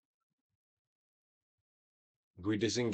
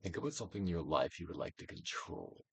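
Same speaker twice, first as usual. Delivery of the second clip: guided meditation; 4 dB louder than usual. Acted. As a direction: coax the listener to let feelings pass.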